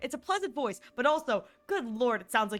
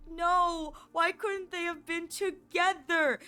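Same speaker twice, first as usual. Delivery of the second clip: higher, drawn-out wail of protest; mock-upset lament; 6 semitones higher, slower than usual. Fan retelling a sad ending, mock-upset lament. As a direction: drag out the retold fan anguish. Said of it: "It made me very sad".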